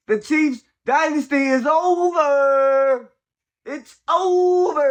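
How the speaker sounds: hammed-up sobbing over the dynasty; mock mourning wail. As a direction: wail it slowly like a fake funeral. Gloating over Chiefs' collapse.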